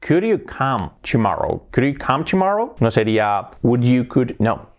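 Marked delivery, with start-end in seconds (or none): 0.1-1.7 slow, word-by-word teacher's example; slow model question. Shown as a demonstration. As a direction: model the question slowly for learners. Teacher models question slowly, then naturally. (pregunta modelo, despacio)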